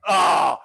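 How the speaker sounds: short loud groan of defeat; frustrated groan. Retold or imitated. Husband caught out by wife, loud frustrated ah. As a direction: let out a loud, beaten ah. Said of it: "I'm like".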